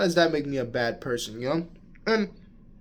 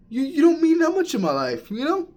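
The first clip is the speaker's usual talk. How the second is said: high, louder indignant retort; heated retort; 12 semitones higher, 5 dB louder than usual. Retold or imitated. Telling the girl she doesn't matter, high indignant voice. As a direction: tell her off in a high, worked-up voice. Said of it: "I was like".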